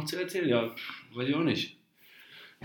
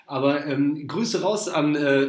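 usual speaking voice

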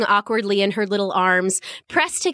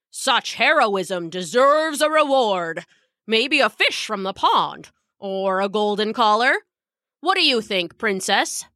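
lightly voiced guard. A narrator's reading. Guard asking the princess about a reward, kindly. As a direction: play a guard indulging a child.

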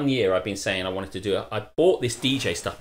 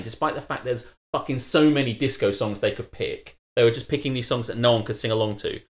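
usual speaking voice

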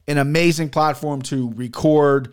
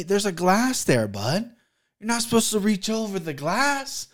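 ordinary delivery

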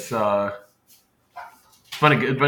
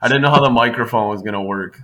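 laughing right into the mic; loud, boomy close-mic laugh; too close to mic; 8 dB louder than usual. Real, not acted. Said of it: "It's so loud".

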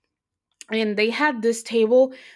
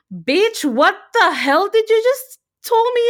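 higher and louder, hammed-up outrage; mock indignant guard; 10 semitones higher, 6 dB louder than usual. Retelling how the guard reacted, mock indignant. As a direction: play the security guard as offended and sassy. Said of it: "he looked at me like".

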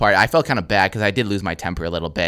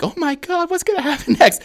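very high, fretful voice; high-pitched pretend worry; mock alarm. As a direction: imitate a scandalized onlooker, amused. Imitates alarmed elderly crowd members.

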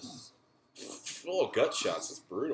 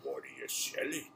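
merchant impression. Player quoting all the merchant's lines, drawn-out put-on voice. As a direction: play the shady shopkeeper, stretching the words. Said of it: "The merchant is like".

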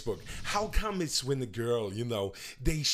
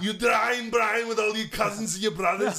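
mock shout; 6 semitones higher, 3 dB louder than usual. Friends mock-shouting at Brian.

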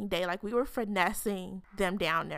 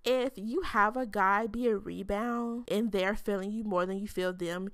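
ordinary talk, nothing marked

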